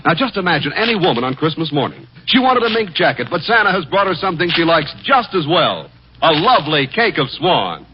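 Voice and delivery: ordinary delivery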